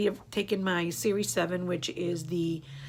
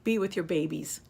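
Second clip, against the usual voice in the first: normal talking voice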